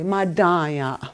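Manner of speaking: "Madaya" with weight on the "da"; second-syllable emphasis. Shown as a demonstration. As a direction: stress the middle syllable of "Madaya". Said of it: "you make a emphasis on the second syllable".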